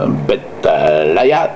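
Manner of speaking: ordinary delivery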